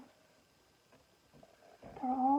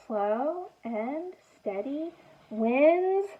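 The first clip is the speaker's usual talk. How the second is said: slow drawn-out refrain; much slower than usual. Acted. Chanting the book's refrain.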